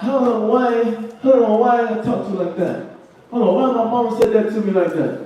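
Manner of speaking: high-pitched mock puzzlement; bewildered complaint. Preacher voicing someone puzzled by rude treatment, high mock complaint. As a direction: imitate someone baffled and put out, pitched high.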